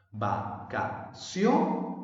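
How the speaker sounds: va-ca-CIO-nes for "Vacaciones", stretched to show the accent; stress on CIO. Shown as a demonstration. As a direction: punch CIO in "Vacaciones", syllables apart. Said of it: "¿Cuál de ellas suena más?".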